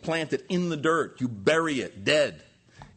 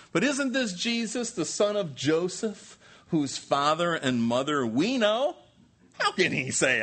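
mock incredulous crowd; 6 semitones higher, 3 dB louder than usual. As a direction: question it like a scandalised sceptic, starting very high. Voicing the doubting crowd's objection.

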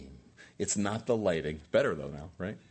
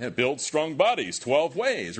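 mock ad slogan; 3 semitones higher, 5 dB louder than usual. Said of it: "Madison Avenue was lying to us".

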